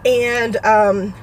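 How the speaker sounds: ordinary talk, nothing marked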